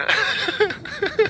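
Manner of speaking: high breathy giggle. Pretend. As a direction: break into a giggle. Pilot giggles mid-announcement.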